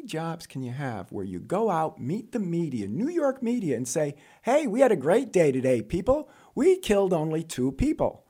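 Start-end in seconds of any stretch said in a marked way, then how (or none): 4.4-8.2 higher, louder upbeat spokesman act; mock press announcement. Transit spokesman addressing New York media, mock upbeat announcement. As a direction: proudly announce it to reporters, tongue in cheek.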